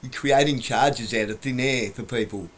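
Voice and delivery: ordinary talk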